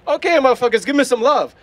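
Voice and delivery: playfully cocky call for attention; demanding affection. Retold or imitated. Costumer out among people fishing for love, brash demand.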